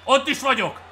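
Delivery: high, loud triumphant call; excited cheer. Real, not acted. Gamer reaching the base, excited shout. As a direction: shout it out as you make the base.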